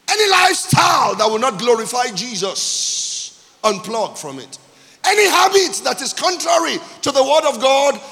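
loud, high-pitched pulpit proclamation; full-force preaching. Real, not acted.

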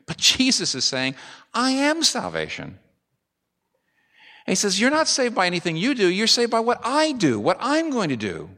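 high, ringing preacher's cry; preaching at full force. Acted. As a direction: lift the whole congregation with it. Climactic preaching about salvation.

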